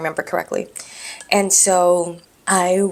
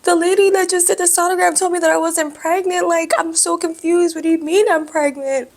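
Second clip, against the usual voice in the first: high, louder, frantic confusion; retold confused outburst; 11 semitones higher, 5 dB louder than usual. Telling the doctor the sonographer said no baby, frantic. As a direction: replay her own bewildered pleading, pitched way up. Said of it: "And I'm like".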